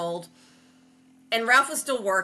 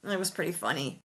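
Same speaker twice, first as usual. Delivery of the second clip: ordinary talk, nothing marked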